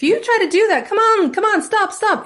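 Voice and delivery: very high, loud mock pleading; silly, piercing high dog-calling voice; high-pitched. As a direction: mimic an owner squealing uselessly at dogs. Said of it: "if it's really high-pitched".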